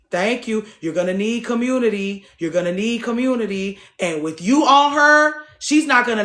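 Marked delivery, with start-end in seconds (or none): 4.0-6.2 rising preacher build; emphatic. Real, not acted. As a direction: drive the point home.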